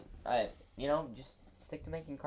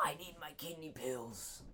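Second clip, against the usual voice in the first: put-on slow, quiet old man croak; 10 dB quieter than usual. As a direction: mumble like a frail grandpa. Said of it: "like a soothing old man voice".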